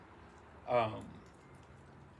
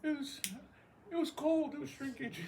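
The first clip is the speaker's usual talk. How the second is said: much higher, whiny excuse; high squeaky joke voice; 5 dB louder than usual. Pretend. Squeaky high voice making the shrinkage excuse.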